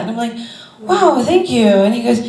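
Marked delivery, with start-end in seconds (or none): 0.8-1.7 flattered surprise. Retold or imitated. Her flattered reply.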